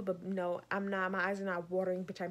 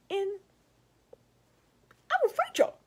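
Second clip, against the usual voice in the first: squeaky, much higher playful threat; mock threat; 11 semitones higher, 4 dB louder than usual.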